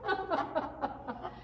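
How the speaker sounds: high, loud burst of laughter through the words; laughing incredulously. Acted. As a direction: crack up at the absurd idea. Shared joke about daughter choosing husband.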